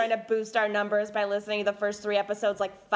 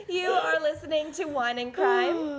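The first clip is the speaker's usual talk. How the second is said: usual speaking voice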